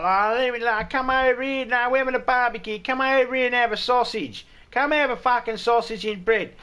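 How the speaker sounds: high, loud hammed-up drunk invitation; friendly drunk Aussie. Drunk inviting a stranger to the barbecue, high loud mock voice. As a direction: beckon like a merry drunk at a barbecue.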